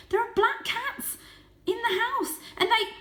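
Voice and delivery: very high and loud, cut off; urgent shout. A narrator's reading. Varjak warns the family about the cats, shouting high. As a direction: shout the warning breathlessly. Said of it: "shouted Varjak".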